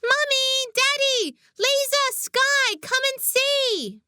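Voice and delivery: high, drawn-out calls to the family; calling out. A narrator's reading. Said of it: "made him call out".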